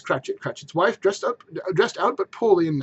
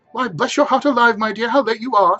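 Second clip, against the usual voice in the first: louder, higher affectionate greeting; fond mother; 7 semitones higher, 7 dB louder than usual. Acted. Mrs Cratchit greets Martha, lightly voiced fond mother. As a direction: welcome her daughter warmly. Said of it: "said Missus Cratchit".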